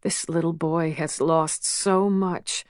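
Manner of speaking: plain reading voice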